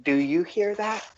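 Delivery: startled, then listening hard; alarmed gnome. Acted. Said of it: "is like".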